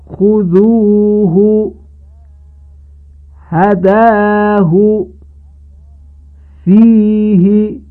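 slow recited examples, final ha kept short; تلاوت بسیار آرام بدون اشباع; Quranic recitation, unlengthened ha. Shown as a demonstration. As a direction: chant خذوه, هداه, فیه very slowly with a short final ه. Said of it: "مانند این موارد، توجه کنید".

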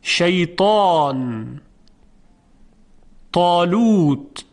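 شیطان طالوت with a thick, full طا; heavy taa. Shown as a demonstration. Teacher showing the heavy طا slowly. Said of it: "مثال برای حرف تا".

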